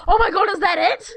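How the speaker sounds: usual speaking voice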